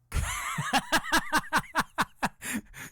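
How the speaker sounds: sudden high cackling laugh; helpless high laugh; burst of high laughter. Real, not acted. Cracks up at own setup.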